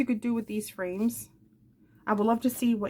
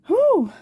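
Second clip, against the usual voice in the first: winded whew; 12 semitones higher, 9 dB louder than usual. Real, not acted. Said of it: "out of breath here".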